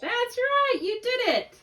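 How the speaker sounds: teacher to student: celebrate the right answer, thrilled and high; excited praise. Real, not acted.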